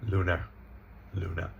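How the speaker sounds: "lunar" as LOO-nuh, LOO-ner; reduced second syllable. Shown as a demonstration. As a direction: let the end of "lunar" fade to schwa. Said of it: "not lunar".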